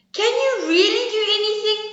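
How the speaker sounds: high squeaky squirrel voice; little squirrel. Acted. Squirrel questioning the bragging bear, high squeaky voice. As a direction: pipe it up small and high.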